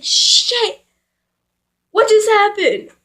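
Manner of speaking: high-pitched startled reaction. Retold or imitated. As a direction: blurt it out as if suddenly grabbed. Startled reaction while being grabbed.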